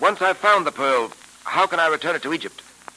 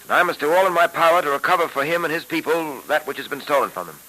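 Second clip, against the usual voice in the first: put-on old radio show. Old-time radio drama episode.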